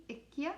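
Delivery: ikke said as ick-yeh; Norwegian ikke. Shown as a demonstration. Teacher pointing out how ikke sounds. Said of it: "a good thing to point out in this verse is that ikke".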